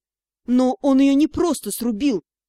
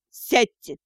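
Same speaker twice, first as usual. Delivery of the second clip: sharp command. A narrator's reading. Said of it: "неожиданно резко скомандовал магистр".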